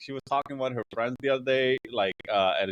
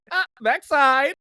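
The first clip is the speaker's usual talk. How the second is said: mock mom tease; 9 semitones higher, 6 dB louder, slower than usual. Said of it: "Not no, like".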